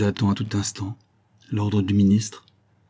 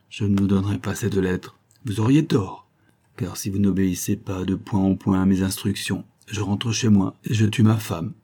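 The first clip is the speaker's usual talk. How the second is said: ordinary delivery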